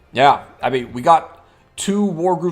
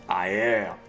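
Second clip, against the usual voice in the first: slow, low menacing character voice; ominous low voice; 6 semitones lower than usual. Acted. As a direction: speak slowly and darkly, like a ghost. Grey Ghost answers.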